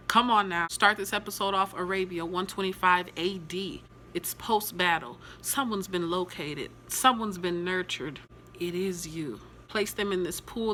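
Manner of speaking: ordinary talk, nothing marked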